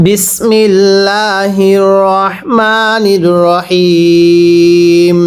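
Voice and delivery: slow, drawn-out chanted recitation; Quranic recitation. Shown as a demonstration. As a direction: intone it slowly, stretching every vowel. Opening recitation before the lesson.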